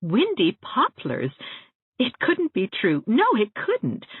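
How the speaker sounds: can't believe her luck; delighted disbelief. A narrator's reading.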